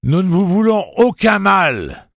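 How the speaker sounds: slow, loud, high-pitched hail; projected shout of reassurance. A narrator's reading. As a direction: call out to strangers far off. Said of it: "cria le pistolero, se servant du haut-parler".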